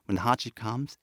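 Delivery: usual speaking voice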